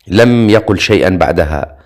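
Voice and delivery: plain reading voice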